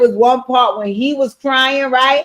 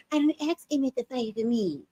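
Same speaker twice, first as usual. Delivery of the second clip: mocking meek voice; 11 dB quieter than usual. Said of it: "with a chick say".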